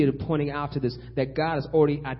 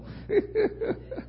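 mocking chuckle. Preacher after Pharaoh's unlikely consent, mocking high chuckle. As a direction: giggle at how absurd it is.

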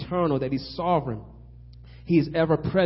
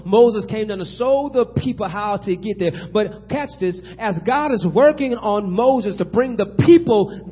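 raised, ringing pulpit delivery; preaching; 7 semitones higher, 9 dB louder than usual. Real, not acted. Preacher rallying the congregation to the kingdom, preaching with force. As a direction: preach it with surging emphasis on key words.

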